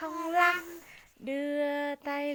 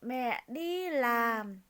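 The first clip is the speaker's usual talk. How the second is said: put-on slow, sing-song reading of a children's poem; poem recitation; 4 semitones lower than usual. Shown as a demonstration. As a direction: recite each word slowly for the child. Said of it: "bài thơ yêu mẹ". (đọc thơ chậm rãi cho bé)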